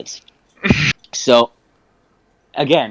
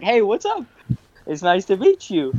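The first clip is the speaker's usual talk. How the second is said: bright, much higher hello to a stranger; friendly greeting; 8 semitones higher than usual. Retold or imitated. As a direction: greet her brightly, pitched way up.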